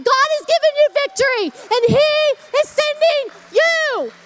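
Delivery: building up to full-force preaching; rising preaching. Acted. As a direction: preach the victory over them with fire. Preaching to the viewers.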